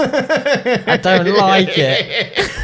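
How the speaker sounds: high, slow and laughing; laughing imitation. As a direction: giggle through the teacher's line. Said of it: "mimicking teacher while laughing".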